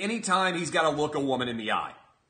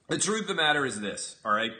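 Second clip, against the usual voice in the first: usual speaking voice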